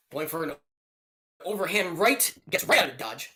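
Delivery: running fight commentary, brisk calls; play-by-play commentary. Acted. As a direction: call each strike like a ringside commentator.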